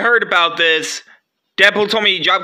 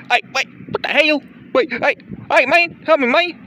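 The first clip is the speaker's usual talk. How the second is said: higher, fast frightened pleading; panicked cry for help; 9 semitones higher than usual. Acted. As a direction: beg for help as the robot attacks. Attacked by robot.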